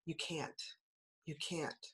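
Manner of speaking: soft, low, nagging doubt; a small voice whispering you can't; nagging inner voice. Retold or imitated. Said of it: "that little negative voice in my mind".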